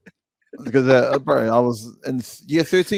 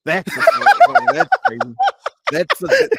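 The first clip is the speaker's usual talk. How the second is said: laughing; 6 dB louder than usual. Real, not acted. Laughing high at banned thing.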